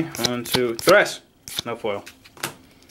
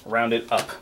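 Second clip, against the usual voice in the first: normal talking voice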